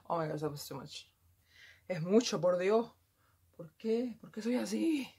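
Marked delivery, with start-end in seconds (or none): 1.9-5.1 loud, raised Spanish lament; dramatic, higher-pitched exasperation in Spanish; exasperated outburst. Real, not acted. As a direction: cry out in dismay at yourself.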